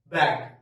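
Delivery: Bag said loud with an open a. Shown as a demonstration. Teacher modelling bag to contrast with bug. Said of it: "so it would be what".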